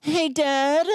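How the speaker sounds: put-on high little boy voice; kid voice. Pretend son calls to his dad in a high voice. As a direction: pitch it up like a small son. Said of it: "he goes".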